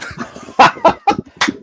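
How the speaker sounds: sudden high burst of laughter; laughing. Real, not acted. Live blunder, host bursts out.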